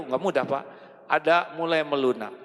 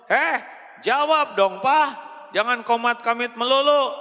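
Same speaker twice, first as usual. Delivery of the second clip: high, loud nagging wife; scolding wife; 8 semitones higher, 4 dB louder than usual. Acted. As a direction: demand an answer, fed up. Wife scolding praying husband.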